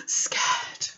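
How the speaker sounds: soft unvoiced whisper. Whispered answer to children.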